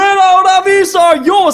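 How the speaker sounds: high, drawn-out excited yell; excited shout. Real, not acted. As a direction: yell it with pure hype. Hits a V-Star card.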